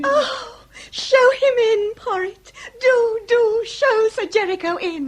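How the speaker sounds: a rush of joy at the news; eager, excited. Acted. Lady tells the butler to admit her lover, overjoyed.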